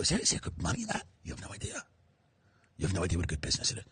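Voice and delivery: hushed, secretive whisper; whispering, as if letting someone in on a shady deal; conspiratorial whisper. Acted. Rich people sharing a trafficking secret.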